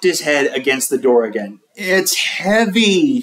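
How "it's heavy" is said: slow, higher whiny complaint; complaining. A narrator's reading. Lewis to the teacher, a slow higher whine. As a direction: whine that it's heavy. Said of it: "he complained".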